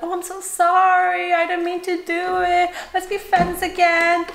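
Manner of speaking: hammed-up make-up plea; mock sweet apology. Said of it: "they'd be like".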